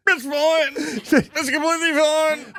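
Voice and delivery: playing it off. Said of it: "play it off like".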